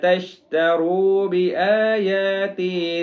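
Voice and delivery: slow, sung tajweed chant; Quran recitation. Shown as a demonstration. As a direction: recite melodically, stretching the long vowels. Recites next verse. (قرآن کی سریلی تلاوت)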